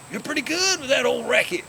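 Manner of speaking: high, loud imitation of an impressed rival; impressed exclamation. Tennis rival after a hard match praises his opponent, impressed. Worn-out opponent grudgingly compliments him.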